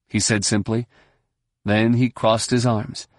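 ordinary delivery, nothing marked

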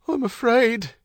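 very high, fearful cry; frightened wail. A narrator's reading. Wife in labour confessing fear to her husband, wailing. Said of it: "Her voice degenerated into a wail".

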